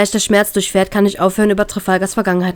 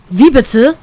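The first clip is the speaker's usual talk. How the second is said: louder, sharper indignant question; louder, angrier; 5 semitones higher, 6 dB louder than usual. A narrator's reading. The brother angrily questions whether she likes Trafalgar. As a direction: snap it back, getting angry. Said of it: "fragt er etwas lauter und wütender".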